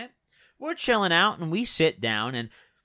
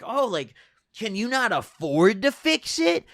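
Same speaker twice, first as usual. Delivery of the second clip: higher-pitched imitation of her put-down; mock rude question; 5 semitones higher than usual. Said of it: "that's a real rude comment".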